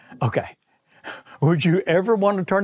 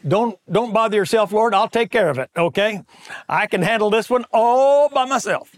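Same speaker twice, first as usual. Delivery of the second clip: higher, louder, brushing God aside; mock eager self-reliance; 6 semitones higher, 4 dB louder than usual. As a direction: play the cocky do-it-myself believer. Preacher voices himself to God.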